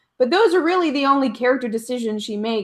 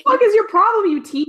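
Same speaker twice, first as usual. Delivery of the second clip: mock exasperated yell; 9 semitones higher, 4 dB louder, faster than usual. S1 voicing Bella scolding Edward.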